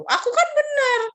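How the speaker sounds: put-on sulky child insisting they are right; whiny protest. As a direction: plead like a pouting child. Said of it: "kita ngomong gitu".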